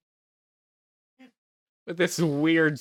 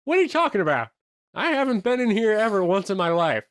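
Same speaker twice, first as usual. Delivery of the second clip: high, protesting mock outrage; indignant denial; 9 semitones higher, 4 dB louder than usual. Craig denying he was there yesterday, indignant denial. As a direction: protest high and louder, disbelieving.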